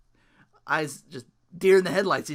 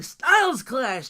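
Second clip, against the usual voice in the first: excited call; 8 semitones higher, 5 dB louder, much slower than usual. Real, not acted.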